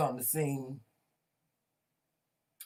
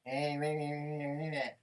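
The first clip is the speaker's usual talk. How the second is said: droning mock grumble; mimicking someone's trash talk; mocking nonsense chatter. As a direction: imitate him with dismissive meh-meh noises. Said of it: "get up talking shit like".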